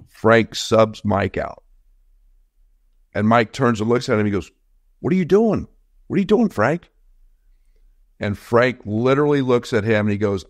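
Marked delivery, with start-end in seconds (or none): 5.0-6.9 high, stunned protest; a star player can't believe he's benched; incredulous player. Retold or imitated. Player protests to his coach, shocked and high-pitched. Mike protests being subbed out.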